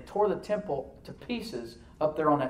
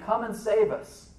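high, drawn-out plea for rescue; crying out; 9 semitones higher, 5 dB louder, slower than usual. Retold or imitated. Crowd pleading with Jesus as he entered their city, crying out. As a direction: call out slowly at a raised pitch.

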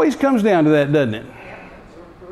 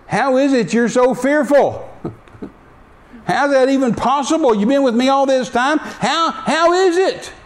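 incredulous Jesus; 7 semitones higher than usual. Retold or imitated. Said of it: "he said".

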